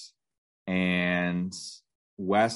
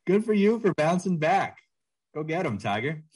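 high, bright mock cheer; cheery congratulation; 9 semitones higher than usual. Host mimicking coworker's reply, high mock cheer. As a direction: pumps it up like a cheesy coach. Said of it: "retelling the coworker's line".

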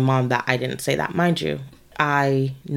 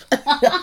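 a quick high burst of laughter; breaking into a brief high laugh; short laugh; 13 semitones higher, 4 dB louder than usual. Real, not acted. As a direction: laugh it out, caught off guard. Laughing mid-sentence.

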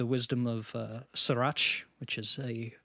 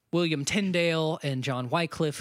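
ordinary talk, nothing marked

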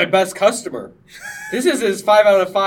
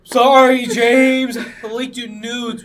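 mock confession; 7 semitones higher, 7 dB louder, much slower than usual. Retelling a sleepy confession.

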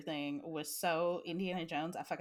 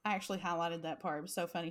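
normal talking voice

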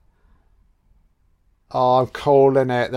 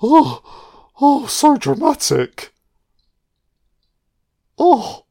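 mocking; 12 semitones higher, slower than usual. Mocking a character's scream.

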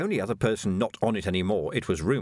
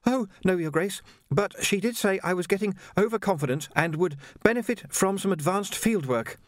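put-on much higher, polite young Jocasta, a touch breathless; young woman voiced; 8 semitones higher than usual. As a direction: play a well-mannered girl treading water. Jocasta answers.

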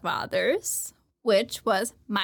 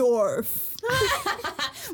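Smash Bros announcer; 3 semitones higher, much slower than usual. Acted.

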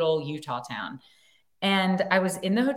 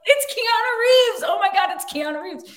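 starstruck squeal; 6 dB louder than usual. Retold or imitated. Actor spotting Keanu Reeves in a hotel lobby, squealing starstruck. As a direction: gush it out like a starstruck fan. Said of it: "And I'm like".

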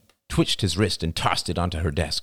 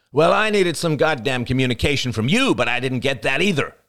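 raised voice, high and forceful; angry retort; 9 semitones higher, 4 dB louder than usual. Acted. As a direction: fire back in a lovers' quarrel. Acted quarrel, angry retort.

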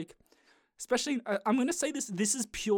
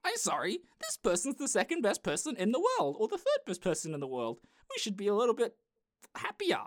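exasperated retort; 5 semitones higher than usual. Retold or imitated. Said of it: "It's like".

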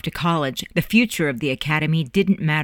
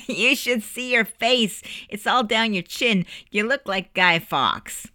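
high, slow, giggly teasing; amused, higher-pitched jeering; laughing mockery; 6 semitones higher than usual. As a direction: tease a friend through laughter. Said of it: "couldn't stop laughing at each other".

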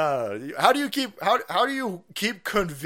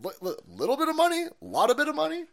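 higher mocking disbelief; incredulous echo; 11 semitones higher, faster than usual. Real, not acted. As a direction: scoff at the phrase.

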